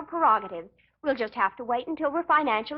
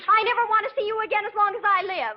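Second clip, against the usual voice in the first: heated squabble; 7 semitones higher than usual. Acted. Young couple trade petty insults in a row, heated.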